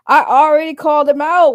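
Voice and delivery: real laugh breaking into the line. Real, not acted. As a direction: crack up as you answer the chat. Laughs answering chat.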